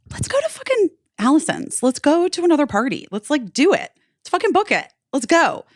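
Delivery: rally him to leave right now; excited urging. Retold or imitated.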